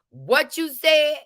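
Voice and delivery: drawn-out high "What you said?"; slow sassy YouTube catchphrase; sassy vlogger voice; YouTube diction. Retold or imitated. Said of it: "using my YouTube, you know, diction".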